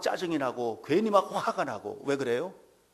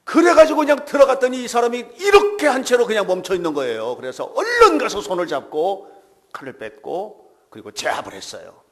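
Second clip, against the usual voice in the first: loud, fervent sermon delivery; preaching at full force; 11 semitones higher, 13 dB louder than usual. Acted. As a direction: preach it out with full conviction to the congregation. Preaches loudly.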